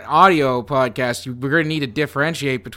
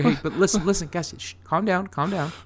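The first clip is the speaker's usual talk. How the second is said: hushed; 2 semitones higher, 6 dB quieter, faster than usual. Acted. Ghost hushing the hosts.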